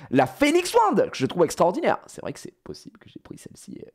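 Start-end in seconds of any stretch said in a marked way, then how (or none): 0.1-2.0 bursting high and loud on the wand name; excited reveal. Real, not acted. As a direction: present it like a showstopper. (annonce enthousiaste, beaucoup plus aiguë et forte)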